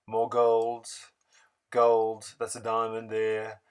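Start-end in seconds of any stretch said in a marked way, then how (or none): none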